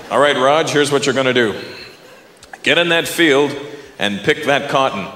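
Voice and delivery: a hint of a low commanding overseer; slave owner. Owner orders Raj into the field, lightly voiced command.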